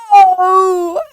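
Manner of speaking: loud high pained howl. A narrator's reading. Door slams on the wolf's nose, loud howl. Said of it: "howled the wolf".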